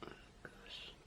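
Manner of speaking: ordinary talk, nothing marked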